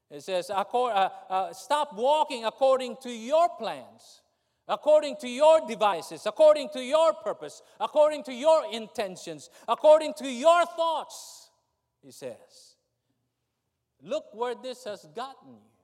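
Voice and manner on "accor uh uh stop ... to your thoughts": loud, high preacher's cry; impassioned sermon delivery; preaching at full force. Acted. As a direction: preach it out with fire.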